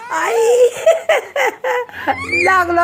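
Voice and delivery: high, loud, half laughing half alarmed; high, loud alarmed laugh after the bump; startled laughing outcry. Real, not acted. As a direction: yelp then laugh it off loudly. Child bumps head, mother reacts.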